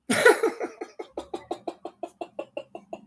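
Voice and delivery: long unvoiced laugh. Real, not acted. Streamer tickled by his pillow insult, laughing. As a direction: crack up in a long rattle of breathy bursts.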